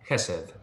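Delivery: Kesed stressed on Kes; Kesed falling away after a stressed Kes; first-syllable stress. Shown as a demonstration. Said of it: "The accent is on the first part".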